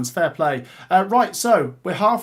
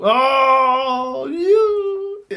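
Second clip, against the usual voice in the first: a much higher, louder cry of delight; excited squeal; 7 semitones higher, 5 dB louder than usual. Real, not acted. Host squealing with delight at Palace v Millwall. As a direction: yelp with glee at the draw.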